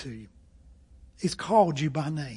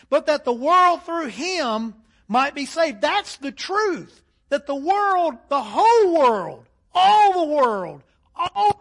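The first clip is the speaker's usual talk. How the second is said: preaching at full force; 8 semitones higher, 7 dB louder than usual. Real, not acted.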